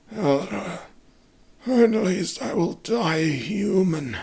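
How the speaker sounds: high, slow voice of a dying man; wounded farmer's voice. Acted. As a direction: Bruno resigned on his sickbed, high and slow. Wounded farmer accepts his fate.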